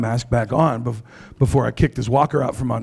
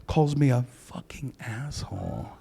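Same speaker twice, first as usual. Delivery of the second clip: much softer, slow grumble under the breath; muttered insult; 3 semitones lower, 10 dB quieter, slower than usual. Retold or imitated. Comic retelling an old man's insult, a soft slow mutter. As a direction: mutter it like a grumpy old man.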